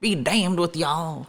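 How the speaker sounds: put-on slow Southern accent, laughing through it. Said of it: "like, a Southern accent".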